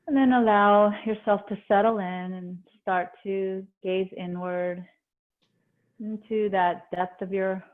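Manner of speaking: calm meditation voice, long pauses between phrases; guided meditation. Acted. Yoga teacher easing students into breath awareness, guided meditation. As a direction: settle them gently inward, leaving space after each instruction.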